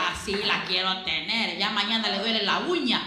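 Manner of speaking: low, hushed scheming devil; gloating enemy. Acted. El enemigo plotting against the silent believer, gloating low and soft.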